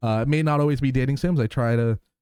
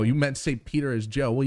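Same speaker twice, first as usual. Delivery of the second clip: ordinary talk, nothing marked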